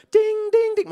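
high, loud ding ding ding; imitated phone ding. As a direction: chime like a phone going off.

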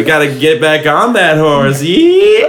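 high imitation cowgirl whoop on "Yee"; playful horse-riding cheer rising to a squeal; mock giddy-up. Host imitating Summer riding like a horse, mock giddy-up whoop. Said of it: "she does the giddy up thing".

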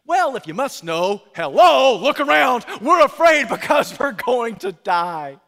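high, loud, mocking exasperation; sarcastic exasperated retort. Disciples snapping back at Jesus, loud sarcastic outburst.

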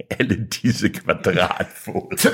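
very slow, drawn-out deadpan reading; slow, dragged-out mock awe; mock repeat. Reader repeats the line mockingly.

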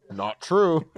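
flat mock deadpan; deadpan denial. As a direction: imitate a blank stone-faced denial. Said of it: "and you're like".

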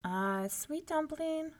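high, slow cooing; doting mother. A narrator's reading. Una soothing baby Finn, cooing. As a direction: coo sweetly to an infant.

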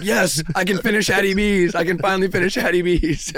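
excited cheer. Retold or imitated. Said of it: "'cause I'm like".

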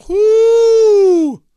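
long, high-pitched whoo; loud whoop. Real, not acted. Host lets out a whoop.

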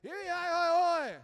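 imitating a two-year-old yelling unclear words; toddler babble. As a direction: shriek baby nonsense like a two-year-old. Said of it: "we don't completely understand her".

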